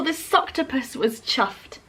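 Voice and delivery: plain reading voice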